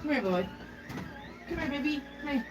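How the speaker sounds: soft, high coaxing call. Real, not acted. Grandma coaxing toddler into pull-up.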